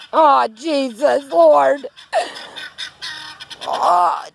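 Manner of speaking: real fright at a rooster; frightened groan. Real, not acted. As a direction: moan it in dread.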